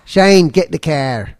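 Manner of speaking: slow, stretched Australian accent; broad Australian vowels, drawn out slowly. Shown as a demonstration. Practising the phrase from Leslie's letter, slow Australian accent. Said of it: "If you wanna do a good Australian accent".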